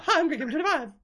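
very high mock intro voice; Gray Gamer. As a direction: squeak it out as a put-on gamer intro. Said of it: "in a totally different voice".

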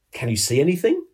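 mildly anxious storybook question. A narrator's reading. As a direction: ask it in suspense, lifting to the end. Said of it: "inquired anxiously".

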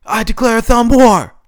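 high, very loud referee's count; full-force shouted match start. A narrator's reading. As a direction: shout each count separately, building to a drawn-out bellow. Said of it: "He then shouted".

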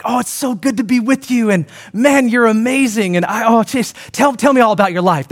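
high, bubbly mock-gushing friend; a hammed-up overjoyed pal greeting you. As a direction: play the delighted friend who adores you. Imitating gushing friendly people.

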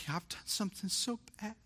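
soft, high, weepy little voice; hushed, high-pitched mock crying; imitated distraught daughter. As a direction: imitate a child tearfully confessing to dad. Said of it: "When my daughter calls me".

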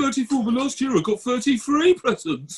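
a bratty cousin yelling about his birthday presents; spoiled kid tantrum. Retold or imitated. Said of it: "the bad guy in that is Dudley".

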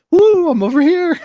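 high, bright peekaboo squeal; playful squeal. Pretend. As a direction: pop up teasingly. Imagined wife popping up.

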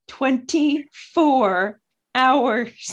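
"Twenty-four hours" drawn out very slowly, word by word; slow, separated emphasis on every part of "Twenty-four hours"; stress on TWENTY-FOUR HOURS. Real, not acted. Stressing the audiobook's excessive length.

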